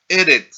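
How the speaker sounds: "Eret" with a loud first syllable; first-syllable stress. Shown as a demonstration. Teacher giving an example of segolate nouns, first-syllable stress on Eret. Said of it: "en la primera sílaba".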